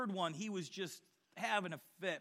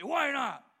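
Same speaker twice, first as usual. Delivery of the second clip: mock defiant retort, louder; sharp retort; 7 semitones higher, 7 dB louder than usual. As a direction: throw back the challenge. Coworker's retort in quarrel story.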